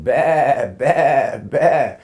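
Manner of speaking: loud high bleat, bah bah bah; goat bleating. Retold or imitated. A four-year-old recalls the goat, loud goat bleat imitation. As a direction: mimic a goat bleating loudly.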